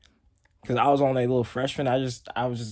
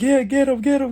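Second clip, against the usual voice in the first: much higher, louder egging on; hyped cheering; 11 semitones higher, 5 dB louder than usual. Retold or imitated. Freshman cheering teammates on, higher and louder. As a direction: pump up the crowd. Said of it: "I was like".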